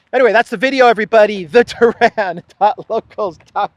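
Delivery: upbeat sign-off. Real, not acted. Host signing off to viewers, loud and laughing. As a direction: wrap it up with a big laugh.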